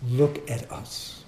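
ordinary delivery

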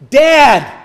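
loud, high cry for Dad; child's cry building to a shout. Acted. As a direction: call out urgently for your father.

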